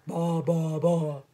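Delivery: very low goat bleats; low-pitched goat voice. Acted. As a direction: bleat deep like a billy goat. Billy goat character bleats.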